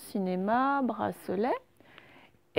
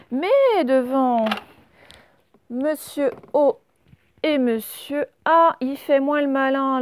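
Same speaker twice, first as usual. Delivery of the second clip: louder, livelier story voice; animated storytelling; 3 semitones higher, 6 dB louder than usual. Acted. Teacher telling the class the pickle's story, animated. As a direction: build the suspense for the children.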